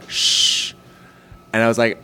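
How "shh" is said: long hushed "shh"; conspiratorial shush. Retold or imitated. Telling friends about a secret text, drawn-out shush. Texted secret, hushing sound.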